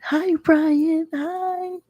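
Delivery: high, sing-song hello; sweet greeting. Acted.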